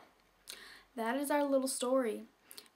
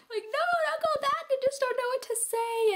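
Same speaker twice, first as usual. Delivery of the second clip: much higher, louder pleading squeak; pleading shy girl; 6 dB louder than usual. Retold or imitated. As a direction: beg him to stay in a high desperate voice. Pleading with crush not to leave.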